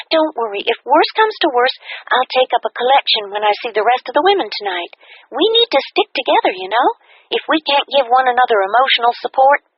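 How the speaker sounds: bright, high, fast upbeat friend voice; cheerful friend. A narrator's reading. Kathy invites her friend to dinner, cheerful and high.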